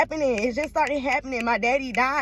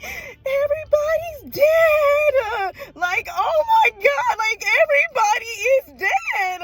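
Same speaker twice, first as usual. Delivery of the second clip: high, slow crying wail; high, loud, slow wailing cry, half acted and half real; mad and sad; 5 dB louder, slower than usual. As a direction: wail it out like grief is breaking through. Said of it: "when I be mad and sad, I be like".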